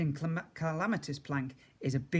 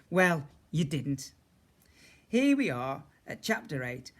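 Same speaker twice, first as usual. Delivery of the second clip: ordinary delivery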